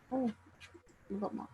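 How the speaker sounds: normal talking voice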